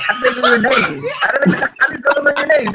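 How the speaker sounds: high, hammed-up indignant demand; mock indignant ex. Voicing his ex about girls knowing his name, mock indignation. Mimics the jealous ex's alarmed question.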